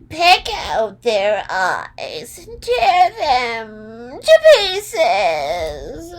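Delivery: high, loud, slow witch voice; wicked witch command. A narrator's reading. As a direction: stretch each word like a cackling witch giving orders. Wicked Witch commands her wolves.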